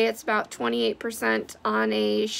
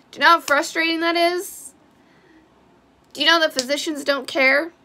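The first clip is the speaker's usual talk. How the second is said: frustrated outburst; 5 semitones higher, 3 dB louder than usual. Real, not acted. Angry rant at doctors.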